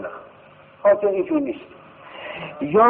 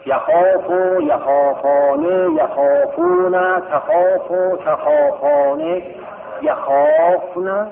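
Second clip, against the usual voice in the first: slow conjugation drill; 7 semitones higher, slower than usual. Shown as a demonstration. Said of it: "یخافو رو صرف کنیم ببینم".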